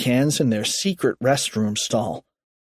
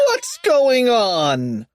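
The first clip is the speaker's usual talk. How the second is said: slow, drawn-out higher holler; yell; 10 semitones higher, much slower than usual. A narrator's reading. Kipper finding screaming cheerleaders, drawn-out yell. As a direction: stretch the words into a yell. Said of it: "yelled Kipper".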